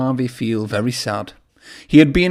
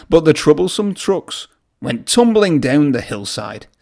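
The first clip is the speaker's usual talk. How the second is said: ordinary delivery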